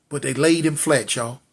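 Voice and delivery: ordinary delivery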